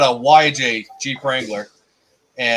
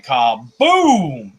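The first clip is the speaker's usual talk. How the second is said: imitated loud bang. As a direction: stretch out the explosion for the story. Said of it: "It was so loud".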